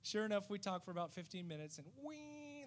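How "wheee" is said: high, soft, drawn-out motor noise; tiny engine whine. Retold or imitated. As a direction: imitate the little boat puttering back. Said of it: "the little boat comes back".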